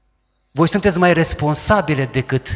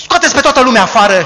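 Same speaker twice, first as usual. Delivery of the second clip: fast, loud, higher barked order; commanding order; 8 semitones higher, 10 dB louder, faster than usual. Acted. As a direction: clear the room with a brisk command. Amnon orders everyone out.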